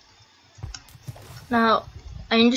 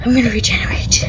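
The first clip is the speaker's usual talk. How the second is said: excited loud whisper; 3 semitones higher, 6 dB louder than usual. Real, not acted.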